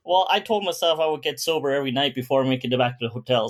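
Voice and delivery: usual speaking voice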